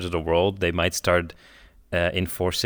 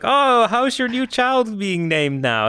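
slow high mock parent voice; 12 semitones higher, much slower than usual. Mock hospital naming scene.

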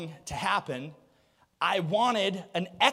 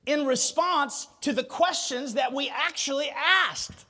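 preaching; 5 semitones higher, 6 dB louder than usual. Acted. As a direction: drive it home from the pulpit. Preaching at full force.

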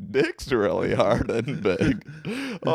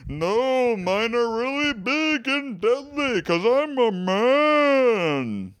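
high, slow booming wizard; game show announcer; 10 semitones higher, slower than usual. Acted. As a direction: boom it like a prize announcer. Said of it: "You sound like a game show announcer".

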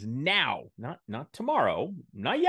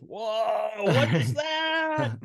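high, drawn-out astonished "whoa"; hammed-up amazement, voice shooting way up; stunned reaction; 9 semitones higher, 4 dB louder, much slower than usual. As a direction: re-enact being stopped dead by shocking news. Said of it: "completely arrested with".

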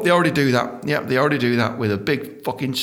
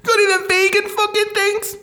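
much higher, pleading customer voice; squeamish customer. Retold or imitated. Put-off customer asking clown for vegan burgers, high pleading. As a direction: beg the clown for a vegan option. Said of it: "You'd be like".

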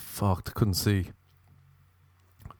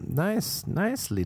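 sarcastic; 11 semitones higher, slower than usual. Real, not acted. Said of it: "sarcastic nice".

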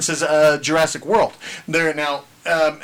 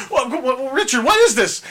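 higher stammering shock; shocked mom; 7 semitones higher than usual. Retold or imitated. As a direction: splutter in disbelief at the mess. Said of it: "She goes".

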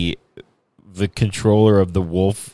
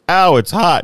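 high, loud hammed-up ouch; mock yelp of pain; 9 semitones higher, 5 dB louder than usual. Henchman grabbing the hot mirror, high loud yelp. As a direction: yelp like your hand just got scorched. Retelling a man burning his hand.

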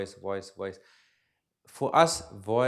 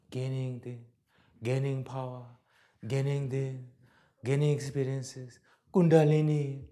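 imitating spiritual seekers. Teacher imitating American spiritual seekers, lightly mocking drone. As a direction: intone each phrase evenly, as a mild parody. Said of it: "I see this in America a lot. Like this".

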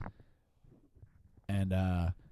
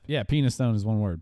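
ordinary talk

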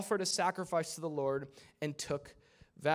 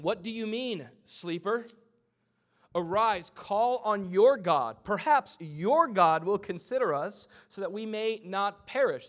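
loud, raised, drawn-out proclamation; forceful scripture reading; 5 semitones higher, 9 dB louder, slower than usual. Acted. Captain rousing the sleeping Jonah, loud urgent call.

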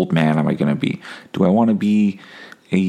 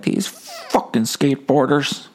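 slow, higher mock grumble at skateboarders; cranky old man; 6 semitones higher, slower than usual. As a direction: ham up an old grouch for laughs. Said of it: "I'll just be like".

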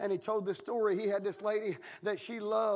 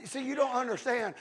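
normal talking voice

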